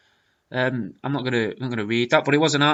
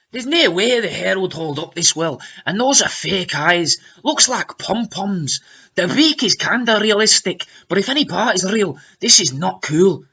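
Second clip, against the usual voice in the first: mock Scottish commenter, lilting up and down; strangely acquired Scottish accent; 4 semitones higher, 4 dB louder than usual. Reading Vanessa's comment aloud, strangely acquired Scottish accent. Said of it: "in a strangely acquired Scottish accent".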